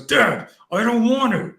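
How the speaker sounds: very high, loud mock child's whine; imitated wailing protest. Son refusing to hit dad's hand, mimicked wailing. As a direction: wail it like a small boy refusing. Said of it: "he's wailing like".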